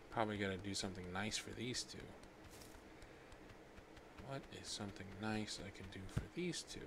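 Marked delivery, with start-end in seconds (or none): none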